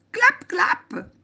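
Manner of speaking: high, loud, punchy clap-clap; applause imitation. Teacher applauding the boy, high imitated claps. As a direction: exclaim it sharply like applause. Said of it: "gesto di applaudire ed esclamò".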